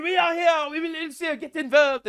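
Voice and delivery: high, loud hammed-up French accent; mock French voice. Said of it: "France is like".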